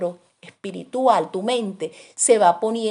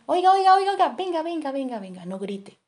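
calling out; 4 semitones higher than usual. Retold or imitated. Preacher calls to a searching mother, high then fading. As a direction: hail the frantic mother, then calm her. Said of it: "Y yo le hago así".

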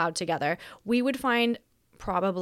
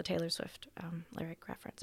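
whispered aside; 4 semitones lower, 15 dB quieter than usual. Real, not acted. Whispered aside about song reference.

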